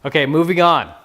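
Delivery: ordinary delivery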